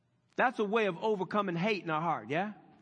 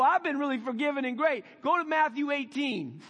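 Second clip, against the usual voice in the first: louder, higher, building sermon cry; preaching at full force; 5 semitones higher, 4 dB louder than usual. Acted. Preacher urging the congregation, rising to a shout. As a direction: drive it home from the pulpit.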